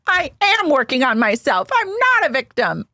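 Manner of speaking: high, hammed-up wounded voice; mock Shannon. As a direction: pitch it way up, self-pitying. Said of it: "She goes".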